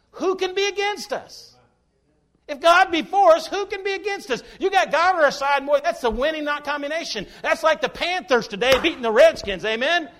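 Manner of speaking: high, loud preacher's cry to the flock; full-force preaching. Acted. Preacher rousing his congregation, loud and high.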